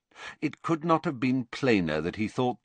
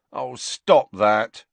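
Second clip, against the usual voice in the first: slow, drawn-out plea, a bit louder; mild begging; 3 semitones higher, 6 dB louder, much slower than usual. A narrator's reading. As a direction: beg her to stop, dragging the words. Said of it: "Ron begged her".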